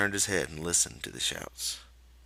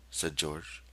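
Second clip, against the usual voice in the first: usual speaking voice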